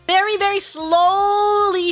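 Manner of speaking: slowly drawn-out words. Shown as a demonstration. Describing slow text popping up, drawn out very slowly. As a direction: stretch SLOWLY right out. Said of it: "very, very slow text popping up".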